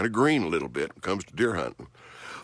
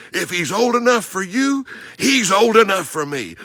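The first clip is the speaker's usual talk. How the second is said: higher, louder, building chant; mock eager hunter at full pitch; excited hunter quote; 13 semitones higher, 10 dB louder than usual. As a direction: hammer the hunter's point home. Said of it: "the same thing that Chris said".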